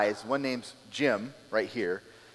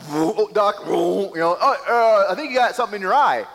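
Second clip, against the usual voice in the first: high, loud, quick fake concern; hammed-up helpful hypocrite; mock clueless guy; 8 semitones higher, 8 dB louder than usual. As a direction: act the oblivious brother offering help. Mocking the plank guy to brother.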